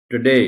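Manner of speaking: Today as tuh-DAY, loud second syllable; quick light टु, strong rising डे in today; stress on D. Shown as a demonstration. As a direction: punch डे in today. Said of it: "D पर stress है".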